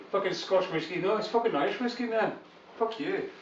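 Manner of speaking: put-on high, sweary Scottish accent. Arguing over Scotch or Irish whiskey, in a Scottish accent. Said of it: "I just got this Scottish accent".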